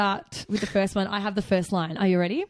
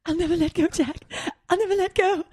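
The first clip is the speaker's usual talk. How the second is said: hammed-up Rose; 8 semitones higher, faster than usual. Rose's pledge, hammed up.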